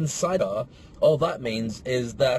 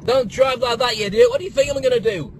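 loud, high shout at another driver; angry shouting; 8 semitones higher, 7 dB louder than usual. Real, not acted. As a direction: yell at a bad driver.

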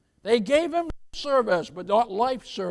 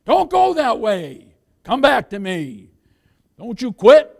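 pleading call; 3 semitones higher, 5 dB louder than usual. Retold or imitated. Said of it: "That voice says".

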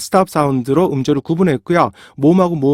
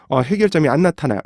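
normal talking voice

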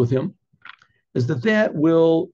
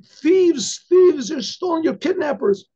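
screaming wife; 11 semitones higher, slower than usual. Acted. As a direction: shriek it out, raising the alarm. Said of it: "his wife is screaming".

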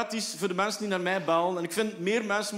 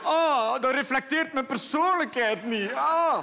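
dismayed; 6 semitones higher, slower than usual. Retold or imitated. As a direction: fret over your own voice. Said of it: "hoor het zelf van".

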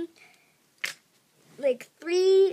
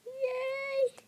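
excited squeal; 10 semitones higher, 8 dB quieter than usual. Real, not acted. Excited cheer to viewers.

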